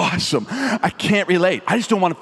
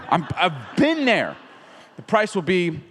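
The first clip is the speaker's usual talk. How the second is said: usual speaking voice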